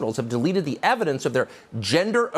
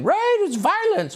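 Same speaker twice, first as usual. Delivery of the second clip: high, slow, louder sing-song mockery; hammed-up cable-news sing-song; sing-songy mock voice; 4 dB louder than usual. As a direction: parody a smug pundit's lilt. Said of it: "she'll be on cable news saying".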